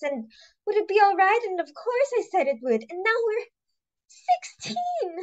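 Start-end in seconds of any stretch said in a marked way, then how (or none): 2.9-5.2 dismay. Acted. Wailing the number in dismay.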